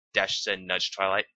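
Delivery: plain reading voice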